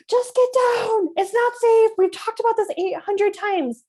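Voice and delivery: high, loud exasperated parent outburst; escalated yell. Shown as a demonstration. Parent losing it at a child on the coffee table, escalated yelling. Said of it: "make sure our tone of voice is calm but firm".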